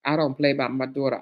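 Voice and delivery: ordinary talk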